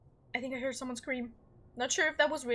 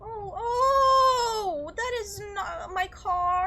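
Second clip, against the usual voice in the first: loud, higher, alarmed disbelief; shocked shout; 8 semitones higher, 9 dB louder, much slower than usual. Real, not acted. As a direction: yelp as the scare lands, then drag out the question. Finds a picture of his car.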